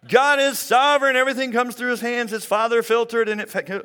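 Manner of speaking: mock the pastor who rattles off platitudes; preachy verse-quoting. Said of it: "I did not start quoting verses".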